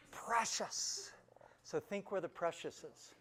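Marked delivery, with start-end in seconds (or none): 0.1-1.1 high rasping creature cry. Retold or imitated. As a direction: imitate a treasure-obsessed creature in one word. Retells doing the creature voice.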